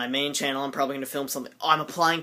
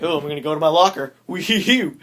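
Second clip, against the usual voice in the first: giddy singsong; 3 semitones higher, 7 dB louder, faster than usual. Retold or imitated. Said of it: "and I'm like".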